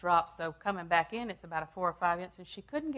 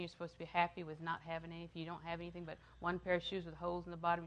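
ordinary delivery

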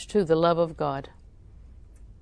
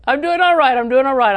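self-assurance; 5 semitones higher, 7 dB louder than usual. Retold or imitated. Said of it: "I think".

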